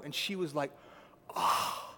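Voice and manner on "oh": mock shocked gasp; breathy, voiceless "oh" of outrage; appalled gasp. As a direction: imitate someone gasping, appalled. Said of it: "everyone gasped".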